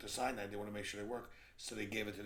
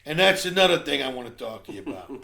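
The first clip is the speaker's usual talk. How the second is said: loud, high comeback; shouting husband; 6 semitones higher, 20 dB louder than usual. Acted. Husband firing one more complaint at his wife, angry shout. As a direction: yell back as the griping husband.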